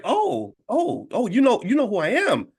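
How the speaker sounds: high-pitched dawning surprise; surprised realization. Retold or imitated. Traveler learning the airline lady knows him, pleasantly surprised. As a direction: re-enact suddenly realizing you've been recognized, pitch jumping up.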